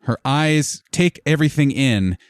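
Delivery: ordinary delivery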